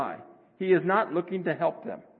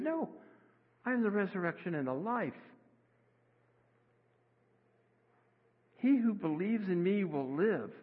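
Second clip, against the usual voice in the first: soft, tender Jesus, quieter; 4 dB quieter than usual. Retold or imitated. Jesus comforting grieving Martha, soft and tender. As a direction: gently comfort a grieving sister. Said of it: "in a soft and tender voice".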